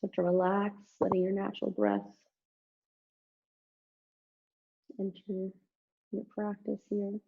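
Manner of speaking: soft, calm relaxation guidance; guided meditation. Acted. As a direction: ease them gently into rest. Leads Shavasana relaxation.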